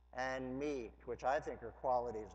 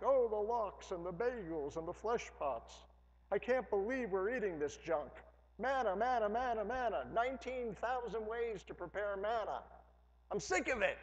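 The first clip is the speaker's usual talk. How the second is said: high, louder whining mockery of grumblers; whiny complaining Israelites; 6 semitones higher, 4 dB louder than usual. Pastor mocks Israelites complaining about manna, high whiny voice. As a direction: whine like spoiled people sick of their food. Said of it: "They're like".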